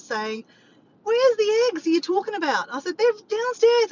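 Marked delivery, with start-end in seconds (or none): 1.0-2.7 high, loud imitation of his yelling; retold yell. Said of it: "he was yelling at me downstairs". 3.0-3.9 high, loud reenacted shout; yelling back. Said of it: "I'm yelling back and forth".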